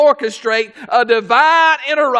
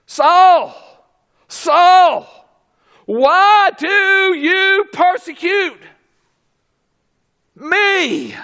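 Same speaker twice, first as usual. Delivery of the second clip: higher, louder, slow solemn calling; voice of Jesus; 7 semitones higher, 3 dB louder, much slower than usual. Acted. Jesus calling down to Saul, slow and solemn. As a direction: call him slowly, as from heaven.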